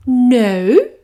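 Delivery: high, loud teacher dragon; a booming schoolmistress dragon calling from the sky; Madam Dragon. Acted.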